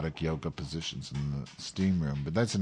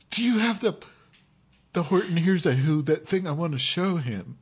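stoner friend; 9 semitones higher, 3 dB louder than usual. Retold or imitated. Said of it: "He's like".

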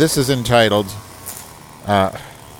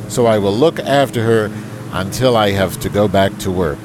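higher, formal letter-reading voice; email read aloud; 5 semitones higher, 4 dB louder than usual. Acted. Host reading Roger's email aloud to the audience, higher and formal. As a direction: put on a proper, correspondence tone.

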